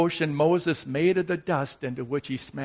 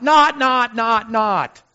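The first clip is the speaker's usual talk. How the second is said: loud, high, insistent nots; mock stern chant of not; hammering no; 8 semitones higher, 9 dB louder, slower than usual. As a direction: pound each not like a gavel.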